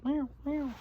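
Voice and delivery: high, soft playful meowing; cat meow. Pretend. Child playfully meowing like a cat. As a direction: mew like a little kitten.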